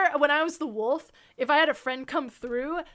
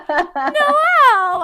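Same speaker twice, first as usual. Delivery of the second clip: high, loud cry of recognition; delighted shout; 9 semitones higher, 11 dB louder than usual. Retold or imitated. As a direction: call the name out in happy surprise. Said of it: "And they'd be like".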